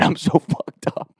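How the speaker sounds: laughing whisper. Real, not acted. Admits being drunk, laughing.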